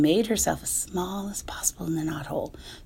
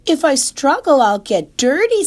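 high, loud worried pig voice; a pig fussing about getting dirty; fretful pig; 9 semitones higher, 9 dB louder than usual. Acted. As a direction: Wilbur protesting to the goose. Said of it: "said Wilbur".

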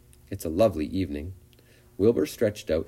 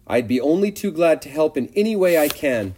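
higher, louder, eager little pig; eager young pig; 8 semitones higher, 5 dB louder than usual. A narrator's reading. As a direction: pester her, trembling with hope. Wilbur's eager offer.

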